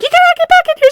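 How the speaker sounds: very high, loud yell; mock friend yelling her back inside. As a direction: shout it to a friend running out. Friend yelling into haunted house.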